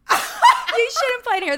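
high, loud and slow, through laughter; laughing repeat. Retold or imitated. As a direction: repeat it high-pitched, cracking up. Repeats the line after the demon take.

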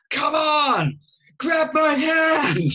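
high, loud, slow pleading shout; mock scream. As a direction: scream at her to grab your hand. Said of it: "screaming at her to grab his hand".

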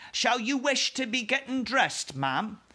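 high, timid maid. Acted. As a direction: ask like a nervous maid. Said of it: "the maid said".

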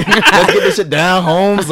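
put-on high, loud, slow East LA Mexican accent; drawn out East LA Mexican homie talk. Friend performs his old East LA Mexican accent, loud and slow. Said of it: "that East L A accent".

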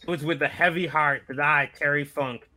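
usual speaking voice

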